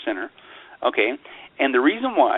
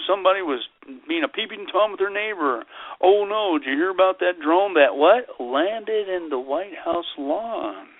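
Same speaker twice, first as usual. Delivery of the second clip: high, sing-song mock worry; mock alarm; 6 semitones higher than usual. Mimicking people passing on drone scare stories, mock alarm. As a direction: parody a scandalised gossip, pitched up throughout.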